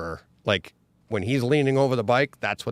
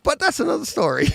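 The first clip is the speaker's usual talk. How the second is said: wry laugh; 4 dB louder, faster than usual. Real, not acted. Ironic aside about crushed bike.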